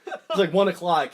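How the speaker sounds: ordinary delivery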